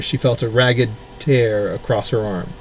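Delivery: ordinary delivery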